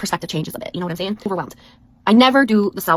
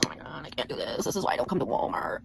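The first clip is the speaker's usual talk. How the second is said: hushed, low, near whisper; overwhelmed muttering; 6 semitones lower, 13 dB quieter than usual. Retold or imitated. Shopper to herself at Walmart, overwhelmed muttering. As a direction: mutter it under your breath, very softly.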